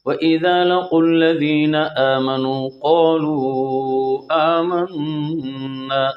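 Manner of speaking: slow melodic tajweed recitation; Quran recitation. Shown as a demonstration. Teacher reciting the verse in full for students. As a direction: chant the verse as tilawat.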